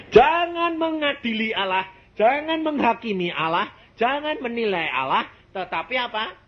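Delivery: high, ringing pulpit exhortation; fervent preaching. Acted. Preacher urging the congregation not to judge God, high and fervent. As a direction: cry it out to the congregation.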